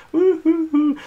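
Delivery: high, loud whooping cheer; a sudden woo-hoo of delight; victory whoop. Real, not acted. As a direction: cheer the win like a fan. X-Men wins the vote.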